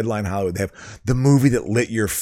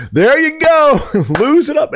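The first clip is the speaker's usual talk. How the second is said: high, loud cheering shout; 14 semitones higher, 5 dB louder than usual. Retold or imitated. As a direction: holler it like a rowdy fan. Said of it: "I was, like, cheering it".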